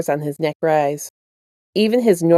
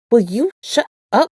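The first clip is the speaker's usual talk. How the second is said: annoyed snap; 4 semitones higher than usual. Acted. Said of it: "Kurogane's voice snapped".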